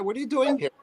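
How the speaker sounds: a friend scolding you in a silly high voice; mock high-pitched scold. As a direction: squeak it like a nagging friend. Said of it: "In that accent".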